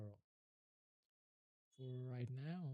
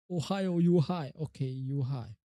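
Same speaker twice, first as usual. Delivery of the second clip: loud repetition; 10 dB louder than usual. Shown as a demonstration. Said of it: "you high, you high".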